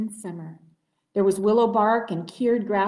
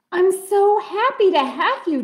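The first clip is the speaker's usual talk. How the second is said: delighted; 10 semitones higher, 7 dB louder than usual. Acted. Missus Moose delighted.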